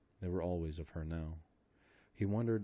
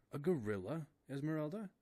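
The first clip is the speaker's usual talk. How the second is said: put-on anxious old man; 9 semitones higher than usual. A narrator's reading. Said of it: "questioned Mister Philander".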